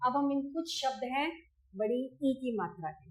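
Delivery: usual speaking voice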